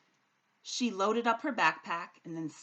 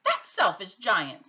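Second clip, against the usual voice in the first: slow, louder complaint; mild cry out; 10 dB louder, slower than usual. A narrator's reading. Kept awake, Sophie cries out in frustration. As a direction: grumble loudly about the giant. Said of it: "Sophie cried".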